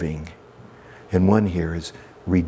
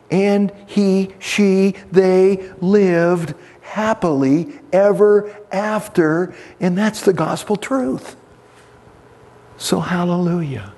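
higher, brighter preaching build; rising preaching; 11 semitones higher than usual. Acted. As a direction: lift the congregation with joy.

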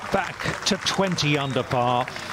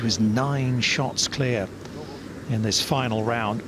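rising, proud victory call over music; golf commentator; 7 semitones higher than usual. Acted. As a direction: announce the winner with lift.

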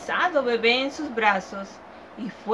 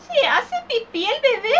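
put-on much higher, louder old woman's question; old woman quizzing the child; 13 semitones higher, 5 dB louder than usual. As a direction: squeak it like a fussy old lady.

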